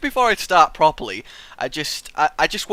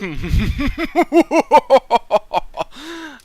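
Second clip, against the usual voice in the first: delighted laugh; 9 semitones higher, 6 dB louder than usual. Real, not acted. Laughing at no twist.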